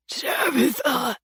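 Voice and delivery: slow weak gasp of her name. A narrator's reading. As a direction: gasp it out, dying. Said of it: "he gasped".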